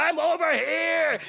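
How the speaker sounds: slow, drawn-out call through the maze; faking his call. Acted. Godmother faking the dying beast, drawn-out call again. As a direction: call her in slowly, pretending to be him. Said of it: "So I have to fake it".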